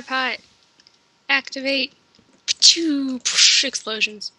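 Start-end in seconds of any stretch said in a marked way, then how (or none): 2.5-3.2 playful spat-out blast; mouth explosion noise. Pretend. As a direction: fake a little explosion. Mimics explosion sound.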